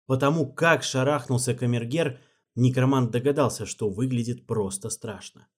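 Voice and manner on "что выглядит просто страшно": plain reading voice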